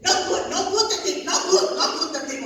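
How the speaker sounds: laughing mock of the lady refusing the dip; mock grouchy manicurist. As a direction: scold like the grouchy nail lady. Mimics grouchy nail lady.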